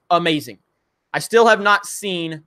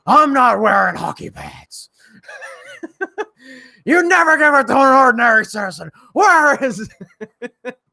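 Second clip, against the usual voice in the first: Bale Batman voice; 5 semitones higher than usual. Retold or imitated. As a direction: snarl it like the caped hero. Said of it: "doing that Bale Batman voice".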